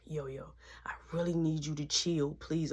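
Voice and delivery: whispered, low please to calm her; calm her down in a hushed voice; soft plea. Retold or imitated. Pleading with Yo-Yo to calm down.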